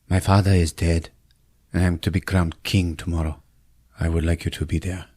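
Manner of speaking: low, measured, formal delivery; solemn prince. Acted. Prince inviting his ex to the coronation, low solemn voice. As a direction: state it low and grave.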